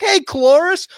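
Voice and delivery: high, loud cheery greeting before the kill; mock friendly call. Andor calling the driver by name, in a high loud mock-friendly voice. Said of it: "He's like".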